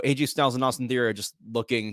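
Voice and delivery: ordinary delivery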